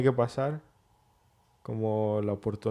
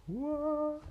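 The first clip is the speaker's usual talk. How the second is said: stunned surprise; 9 dB quieter than usual. Retold or imitated. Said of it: "fue como que".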